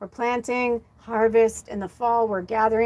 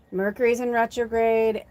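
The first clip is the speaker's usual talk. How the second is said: ordinary talk, nothing marked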